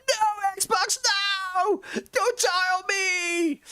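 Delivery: very high, drawn-out melodramatic wail; hammed-up falsetto cry of despair; mock wailing. Streamer imitating Clink pleading with a drowning Xbox, mock wailing. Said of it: "Me making funny noises".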